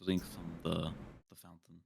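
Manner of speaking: soft, subdued reply trailing off; quiet in-character line. Acted. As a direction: say it quietly, fading almost to nothing. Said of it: "just quietly say".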